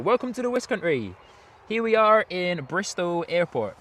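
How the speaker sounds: a hint of a put-on West Country accent. Vlogger welcoming viewers to Bristol, light mock West Country accent. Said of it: "My rendition of a West Country accent".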